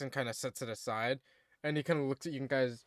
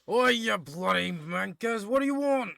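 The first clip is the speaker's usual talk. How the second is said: loud, gruff British shout, slow and high; aggressive British; 6 semitones higher, 5 dB louder than usual. Acted. Dwarf blacksmith yelling at visitors, aggressive British. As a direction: bark at the door in a rough British accent. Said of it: "now he's aggressively British".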